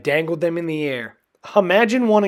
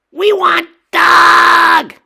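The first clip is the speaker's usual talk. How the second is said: high, loud, slow troll chorus; bellowing trolls; 13 dB louder, much slower than usual. A narrator's reading. Five trolls demanding the dog, bellowing. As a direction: roar the demand together. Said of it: "they bellowed".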